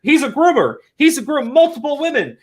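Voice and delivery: high, mock-outraged chant of accusations; mocking Twitter accusers. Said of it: "they're going".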